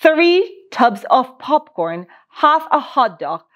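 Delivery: plain reading voice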